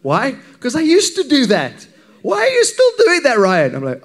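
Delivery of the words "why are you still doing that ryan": high, loud, accusing mock colleague; exaggerated colleague. Worship pastor mimicking colleague's sharp question about the rota. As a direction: overplay her indignant question. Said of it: "she didn't say it in that tone".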